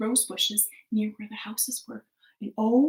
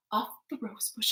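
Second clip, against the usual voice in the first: ordinary delivery, nothing marked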